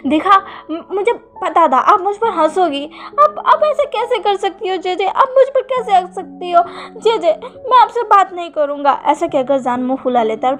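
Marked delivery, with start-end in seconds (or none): 0.1-9.0 louder, higher sulky protest; a wounded little brother snapping back; hurt and angry. Acted. Brother upset that his sister laughs at him, angry sulk. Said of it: "वो गुस्सा हो जाता है".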